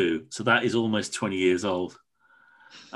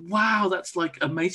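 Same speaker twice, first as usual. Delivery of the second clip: much higher breathless amazement; awed wow; 9 semitones higher than usual. Retold or imitated. Answering the woman from the dig, awed and higher. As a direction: marvel at it, wide-eyed. Reacting to her dig story.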